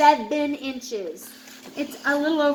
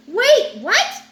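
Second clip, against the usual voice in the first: startled shark; 8 semitones higher, 9 dB louder than usual. Acted. Shark confronts bear in book.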